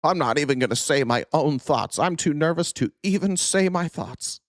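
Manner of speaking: put-on scaredy voice, pitch climbing; mock nervous. Host mocking timid commentators, mock nervous. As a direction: mimic someone too scared to speak. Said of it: "everything is so scary to them".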